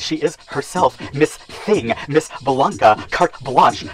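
high, grand ballroom mother voice; imperious house mother. Acted. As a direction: command the room like a house mother. House mother character monologue.

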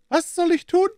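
high, thin pinched voice for the villain; sly villain in a high falsetto; Smith's high voice. Acted.

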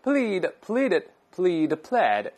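slow, clear reading of "plead，pleaded，plead，pled"; verb forms drill. Shown as a demonstration. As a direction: spell out both past forms slowly. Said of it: "两个都行啊". (慢读"plead，pleaded，plead，pled")

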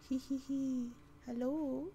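high, creepy giggling voice; faking madness. Pretend.

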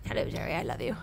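low, soft lovey-dovey voice; mock lover voice. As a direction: croon it like a sappy sweetheart. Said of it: "call Jerry and be like".

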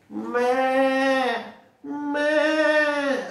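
high bleating goat voice; playful goat meh. Acted. Goats bleating in story.

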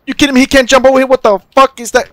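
high, loud disbelieving yell; outraged shout. Real, not acted. Player fuming at a jump he can't make, outraged shout.

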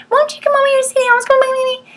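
mock whine at the kids; high, fast, wheedling plea to a child; whining. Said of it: "we whine at our kids".

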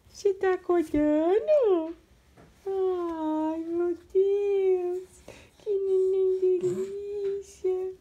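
high, slow, adoring voice. Real, not acted. Mãe encantada com bebê. (voz de mãe babona, aguda e lenta)

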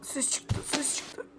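hushed tense whisper. Real, not acted. Hiding player whispering about the noise. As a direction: breathe it so granny won't hear.

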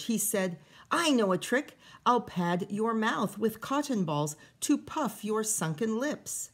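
lively read-aloud storytelling voice; story reading. A narrator's reading. Teacher reading a picture book to kids, lively storytelling. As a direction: read the rhyming story to the children.

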